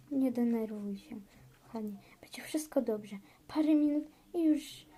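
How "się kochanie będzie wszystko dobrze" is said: whispered reassurance; soothing whisper. Pretend. Husband comforting his wife in labour, whispering.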